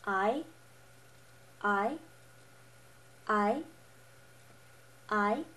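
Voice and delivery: "I" rising like a question; second tone. Shown as a demonstration. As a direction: say "I" with the pitch sweeping up, as if asking. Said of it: "pretend like you're asking a question".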